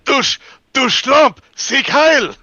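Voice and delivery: angry German shout. Acted. Acting out German villain dialogue.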